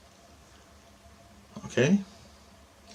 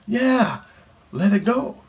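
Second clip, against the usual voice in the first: high, insistent mock yell; imitated yelling; 12 semitones higher, slower than usual. She confirms what he must do, imitated high yell. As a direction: answer in her high, yelling voice. Her yelled answer continues.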